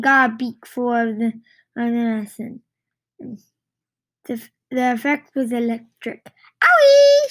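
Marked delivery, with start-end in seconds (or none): 6.2-7.3 very high, loud hen shriek. A narrator's reading. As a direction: squeal in shock like a startled hen. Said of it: "shrieked the hen".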